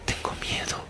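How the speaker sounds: whispered softly, frightened; scared child whisper. Retold or imitated. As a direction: hush it like a scared little girl. Scared little girl speaks.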